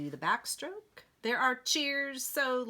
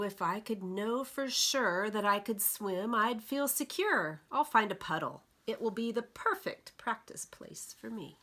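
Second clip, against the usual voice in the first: usual speaking voice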